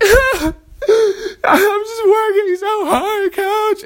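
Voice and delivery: very high, very slow mock sobbing; crying reply. Tired runner answering his coach mid-sprint, hammed-up sobbing. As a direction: whimper it out through fake tears, stretching every word. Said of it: "And I'm like".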